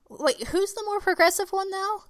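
high-pitched mock puzzlement; pointed high-voiced comeback; mock incredulous. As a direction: ask it high, teasing the critics. Mock disbelief at shocked non-Muslims.

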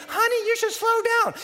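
lightly voiced wife telling him to ease off; a hint of a worried wife; nagging wife. Wife warning speeding husband.

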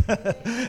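short high chuckle; amused laugh. Real, not acted. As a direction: chuckle briefly at your own point. Joke after "his name is Jesus.

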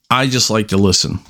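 normal talking voice

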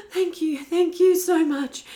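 overwhelmed and near tears; tearful gratitude. Retold or imitated. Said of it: "And I was like".

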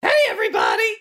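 very high cartoon greeting; squeaky little person. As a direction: chirp it like a tiny munchkin. Mimics tiny lollipop folk.

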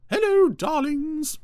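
very high, loud, drawn-out greeting; theatrical high falsetto entrance; gushing Ermintrude. A narrator's reading. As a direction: trill it grandly to the whole company. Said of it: "I want to do the voice".

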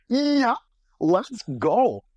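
high, eager mock yes. Accepting the drink invitation, high eager mock reply. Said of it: "And I was".

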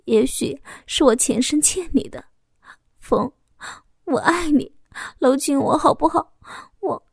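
begging him to hold her, afraid; pleading fear. A narrator's reading. Woman begs her lover, high and crying.

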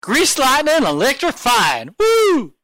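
loud high excited chant; hyped-up cheer. Acted. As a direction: sell it like a rally cry.

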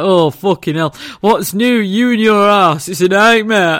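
loud exasperated husband impression; mock exasperation. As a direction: complain loudly, as an exasperated partner would. Imitates husband's exasperated outburst.

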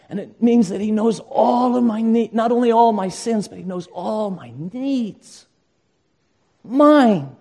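high, loud, fervent pulpit preaching; preaching at full force. Acted. Pastor urging his congregation to come to the shepherd, preaching at full force. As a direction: drive the call home to the whole congregation.

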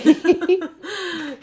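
a bright, high burst of laughter. Real, not acted. Laughing at herself.

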